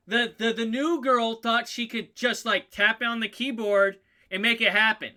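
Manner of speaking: hammed-up scornful shouting; loud mocking. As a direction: announce the newcomer's foolishness to everyone. GM acts mocking the new girl.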